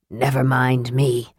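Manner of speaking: low, slow brush-off; impatient. A narrator's reading. Raistlin brushes off Tanis's concern, mildly impatient. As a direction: wave away the concern, lightly impatient. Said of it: "Raistlin returned impatiently".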